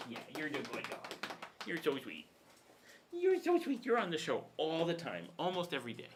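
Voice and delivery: high, sing-song pet voice; high cooing dog talk. Real, not acted. Talking baby talk to his dog.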